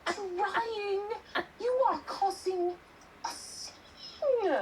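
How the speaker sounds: high, hissed rebuke from a stranger; Tallulah voice. Acted. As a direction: scold them for causing a scene. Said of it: "Tallulah is across the alley".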